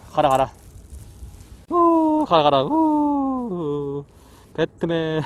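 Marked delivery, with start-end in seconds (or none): 1.7-2.2 long, high-pitched "woo"; drawn-out high hoot; high whoop. Real, not acted. Releasing the fish. 2.6-4.0 long "woo" sliding down from high; high falling hoot; high whoop. Real, not acted. Releasing the fish.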